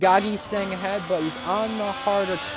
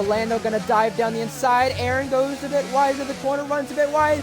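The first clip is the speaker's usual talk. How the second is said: raised, lively race call; motorsport commentator; 9 semitones higher than usual. Acted. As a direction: build the tension of a pass. Live race play-by-play.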